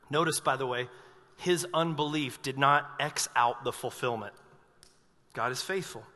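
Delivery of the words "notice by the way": normal talking voice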